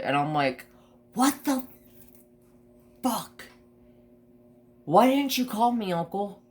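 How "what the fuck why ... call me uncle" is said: high, incredulous yelp of disbelief; shocked outrage. Retold or imitated. Confronting the uncle about the sick puppy, high shocked outrage. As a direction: fire it at him, stunned and angry.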